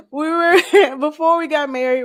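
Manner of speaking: high, loud words squeezed through real laughter; laughing. Real, not acted. Wife restarts her friendship story, still laughing. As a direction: try to get the sentence out while laughing.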